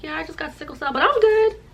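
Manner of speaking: high, mock-casual reassurance; put-on carefree voice; breezy brush-off. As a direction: climb into a squeaky high "I'm good". She brushes off her illness.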